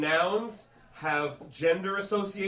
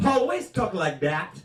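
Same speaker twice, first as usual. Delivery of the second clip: put-on slow, thick French Canadian accent. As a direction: put on mother's heavy French Canadian English. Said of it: "French Canadian accent".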